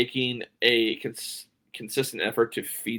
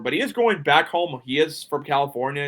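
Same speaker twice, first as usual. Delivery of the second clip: usual speaking voice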